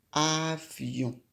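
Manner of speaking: "avion" stretched out sound by sound; drawn-out word. Shown as a demonstration. Teacher introduces the word to write, drawn out "avion". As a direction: say "avion" slowly, lengthening each sound.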